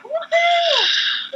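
very high laughing whoop; cheering. Retold or imitated. The girls celebrating their hit, high laughing whoop. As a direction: whoop in triumph.

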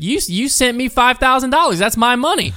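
high, indignant mock granny; lightly hammed-up insistent grandma; imitated old grandma. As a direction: protest in a high old-lady voice. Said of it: "sounded like an old grandma".

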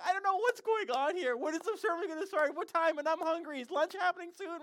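mock-whiny Westerners, high and loud; impatient complaining. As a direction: imitate restless churchgoers in a high, strained complaint.

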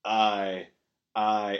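low, lifeless votes with no feeling; bloodless aye. Retold or imitated. Old town council voting in dull unison, bloodless. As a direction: drain every bit of life out of each aye. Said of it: "sort of bloodlessly going".

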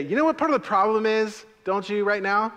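loud, high call-out; pointed challenge. Shown as a demonstration. Pastor demonstrating a reply in a quarrel, loud and pointed. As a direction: throw the question back at them. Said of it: "you just get to say".

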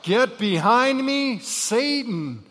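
high, slow, loud command; loud rebuke. Retold or imitated. Parent rebuking at home, loud slow rebuke. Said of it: "used that once or twice".

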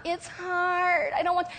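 high, teary, halting reluctant whine. Retold or imitated. Believer balking at giving to Jesus, teary high whine. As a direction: whimper it like a reluctant child dragging feet. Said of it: "doesn't want this to be a reluctant battle".

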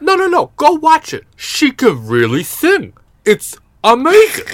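loud, high-pitched mock dad raving; amazed American dads. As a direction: insist excitedly, as a hammed-up astonished dad. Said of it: "all the dads in America went".

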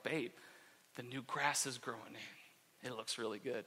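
low, soft mock reverence; hushed pride. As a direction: share it quietly, like precious news. Showing wife the new grass.

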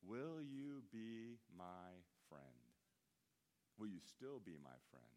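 put-on slow, soft, pleading kid; a child begging to stay friends, softly; small kid's plea. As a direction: softly ask like a hurt eight-year-old. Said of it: "I still remember".

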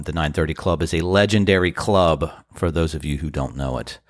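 ordinary talk

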